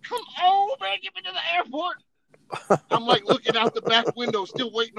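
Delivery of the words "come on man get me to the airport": high, pleading urge; impatient plea. Retold or imitated. Soldier pleading with the bus driver, high and urgent. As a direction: beg the driver to hurry.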